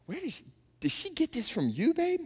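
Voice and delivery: hushed, halting question to a spouse; softly, half-afraid to ask; quiet comic disbelief; mock incredulous question. Mock accusation aimed at wife.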